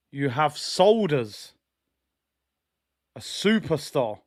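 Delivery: measured, weighty thanks; solemn tribute. Pretend. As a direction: deliver it like a grave formal address. Solemn thank-you address to Guardiola.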